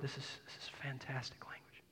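awed whisper; hushed aside in awe of the words. Real, not acted. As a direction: breathe it out in wonder. Marvelling at scripture wording.

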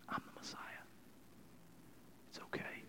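soft whispered reassurance; Jesus whispering comfort to the rejected woman. Acted. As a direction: whisper it like a tender secret.

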